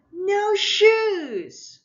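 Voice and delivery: put-on high, loud child's shout. Toddler yelling while pulling off her shoes. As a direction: scream it like a toddler in a tantrum.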